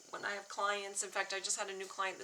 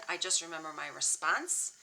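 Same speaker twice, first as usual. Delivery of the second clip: ordinary delivery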